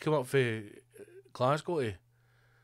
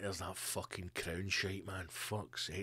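much softer, lower grumble to himself; grumbling prince; 6 semitones lower, 14 dB quieter, slower than usual. Retold or imitated. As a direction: mutter in disgust at the telly. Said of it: "he's like".

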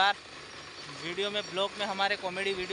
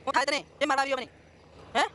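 mock outrage; 7 semitones higher than usual. Real, not acted. Scolding goat, mock outrage.

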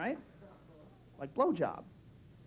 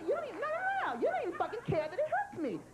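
higher, hurt mock outrage; offended girlfriend; 6 semitones higher than usual. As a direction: scold him like the hurt girlfriend. Acting the girlfriend's anger.

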